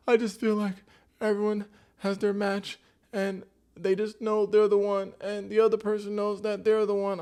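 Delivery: very high, loud whiny wail; mock crying. Recapper imitates crying contestant to viewers, mock crying. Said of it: "Now Sophie's crying".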